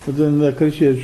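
ordinary delivery

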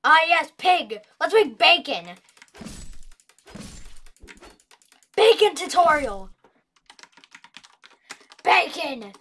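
excited. Real, not acted. Excited about bacon.

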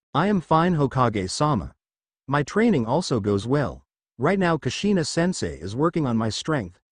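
plain reading voice

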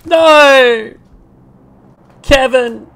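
very high, loud cry of dismay; anguished yell. Real, not acted. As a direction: scream in defeat. Killed by enemy named Kevin.

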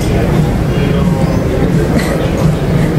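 short low giggle at the sight; a little surprised chuckle; amused laugh. Real, not acted. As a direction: laugh in disbelief. Laughs at bird in airport.

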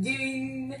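attempted Jeffy voice. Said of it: "let me hear your Jeffy voice".

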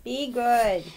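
loud call across the room. Real, not acted. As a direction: call the dogs to behave. Calling over to the dogs.

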